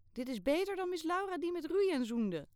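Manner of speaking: high and loud, bursting with news; excited. Acted. Girl thrusts photo at Kayale, high and loud with excitement. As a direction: blurt it out, thrilled to show off.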